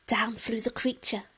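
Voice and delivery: ordinary delivery, nothing marked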